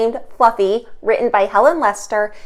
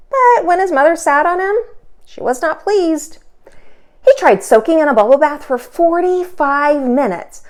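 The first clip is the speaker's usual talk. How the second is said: lively, expressive storybook reading; animated read-aloud for kids; reading to children; 4 semitones higher, 4 dB louder than usual. A narrator's reading. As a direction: read it aloud for little listeners. Teacher reads picture book aloud.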